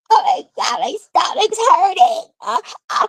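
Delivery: high, slow squealing mock of the host. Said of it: "your host goes".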